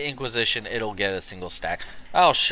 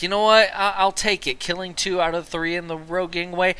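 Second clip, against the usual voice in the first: retold self-talk; 7 semitones higher, 5 dB louder than usual. Retelling his own reaction.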